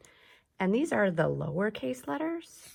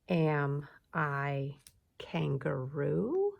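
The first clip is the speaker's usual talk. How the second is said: slow, spaced words, "kangaroo" sweeping up at the end; rising question; 4 semitones lower, much slower than usual. Shown as a demonstration. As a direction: keep "Am I" low and raise the last syllable of "kangaroo". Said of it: "so we're asking questions".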